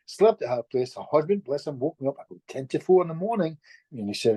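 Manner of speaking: ordinary delivery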